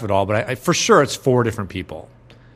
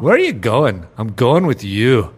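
put-on louder Scottish movie accent; 3 semitones higher, 6 dB louder than usual. Quoting a movie to cohosts, Scottish accent. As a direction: quote Braveheart in a Scottish accent. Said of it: "think of one movie".

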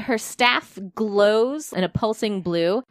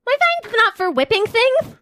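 high, loud panicky voice; high panicked character; 13 semitones higher, 4 dB louder than usual. Acted. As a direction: panic over the burning room. Emmy arguing in panic.

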